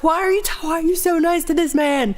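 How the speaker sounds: high, exasperated question; lightly mock outraged; mock indignation. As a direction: demand an answer in hammed-up disbelief. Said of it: "I'm like".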